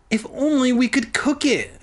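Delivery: a hint of a high hungry girl; lightly voiced eager girl; hungry exclamation. A narrator's reading. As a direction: exclaim it longingly. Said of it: "Sheena exclaimed hungrily".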